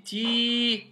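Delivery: TI slow, with the i held out; long vowel. Shown as a demonstration. Teacher explaining the long vowel of ya, drawn out. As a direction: stretch "ti" on a sustained vowel. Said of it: "c'est la voyelle longue de ya".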